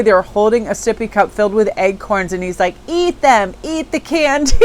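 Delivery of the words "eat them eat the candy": put-on little boy voice. Little boy offering acorns.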